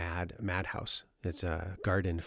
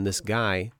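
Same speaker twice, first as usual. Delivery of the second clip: ordinary delivery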